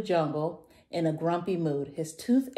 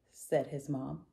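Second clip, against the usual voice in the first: plain reading voice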